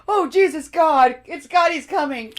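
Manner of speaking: high, loud, hammed-up panic; mock panic. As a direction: play a terrified official seeing God arrive. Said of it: "That's why they were like".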